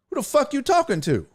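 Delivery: high-pitched retold challenge; indignant challenge. Passenger squares up to driver, indignant challenge. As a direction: throw it at him, offended.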